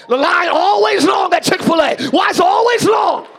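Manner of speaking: loud, high, hammed-up fuming about the line; mock angry rant. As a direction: play the angry person yelling at the Chick-fil-A line. Acting out angry person.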